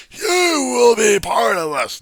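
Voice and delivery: high, slow, roaring preacher; dramatic shout. Shown as a demonstration. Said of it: "Notice the dramatic acting at this point".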